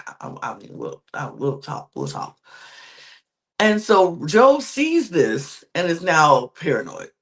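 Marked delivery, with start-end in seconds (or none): 0.1-2.4 unvoiced, hesitant whisper; hushed stammering whisper. Rick murmuring back to Savannah so Joe won't hear, whispered. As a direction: whisper it, trailing off.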